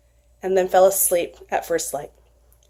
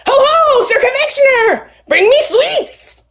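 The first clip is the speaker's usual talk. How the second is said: tiny bee knight calling; 4 dB louder than usual. Acted. Bee knight calls again.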